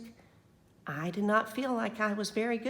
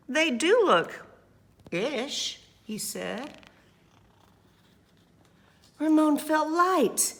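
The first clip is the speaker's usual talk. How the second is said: lively, louder read-aloud voice; story narration; 4 semitones higher, 6 dB louder than usual. A narrator's reading. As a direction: read it to the children with energy.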